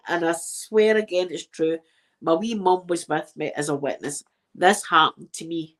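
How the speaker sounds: usual speaking voice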